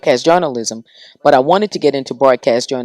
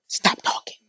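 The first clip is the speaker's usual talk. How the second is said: whispered scold; 8 dB quieter than usual. Retold or imitated. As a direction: stop talking, whispered.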